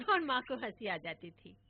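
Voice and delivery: plain reading voice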